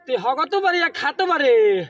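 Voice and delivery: loud teasing call; shouting. Real, not acted. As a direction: yell it across the field. Teasing friend, shouting across.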